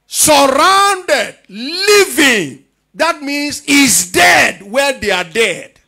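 loud, high, slow proclamation; full-force preaching. Real, not acted. Preacher expounds the verse to his congregation, full-force preaching. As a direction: belt out each phrase, stretching key words.

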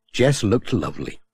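usual speaking voice

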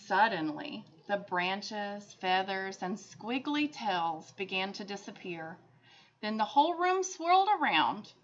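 ordinary delivery

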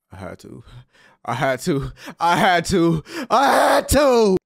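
starts muttered, climbs to a slow yelled I had to; building to a shout. Real, not acted. Gamer justifying himself to chat, rising to a shout. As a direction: escalate each repeat until shouting.

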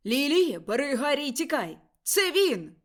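loud, high desperate shout of a warning to flee; man shouting. A narrator's reading. Father shouting to Lily to grab the baby and run, loud panicked yell. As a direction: yell it to the wife with all your breath. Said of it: "кричав чоловічий голос".

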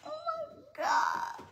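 high, slow, drawn-out gasp of awe; stunned gasp. Retold or imitated. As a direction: let the breath fall out in shock. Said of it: "my heart fell like".

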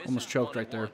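usual speaking voice

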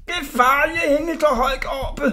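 high, loud wail of alarm; frightened bawling. A narrator's reading. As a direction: bawl it down while dangling up high. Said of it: "vrælede Orla".

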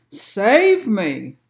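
loud, drawn-out plea to be saved; storybook shout for rescue; cry for help. A narrator's reading. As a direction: call out like a man overboard. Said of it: "he cried".

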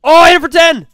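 high, loud burst of surprise; real gamer whoop; excited yell. Real, not acted. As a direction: blurt it out thrilled. Landing a hit in game.

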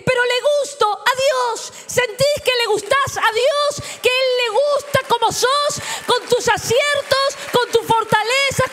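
high, ringing, emphatic sermon voice; high, fervent preacher; fervent preaching. Real, not acted. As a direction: preach it with rising fervour. Impassioned preaching at high pitch.

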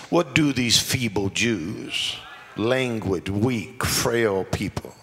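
low, slow scornful sneer; mocking Sanballat. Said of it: "listen to the mockery".

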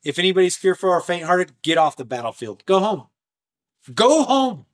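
high, loud order to leave; commanding dismissal. Retold or imitated. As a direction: send the cowards home. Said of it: "he goes".

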